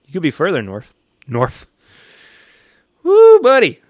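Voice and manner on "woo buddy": high loud whoop, "Woo, buddy" thrown up high; startled whoop at the chat; excited whoop. Real, not acted. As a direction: let out a big delighted whoop, much higher and louder. Reacting to chat comment.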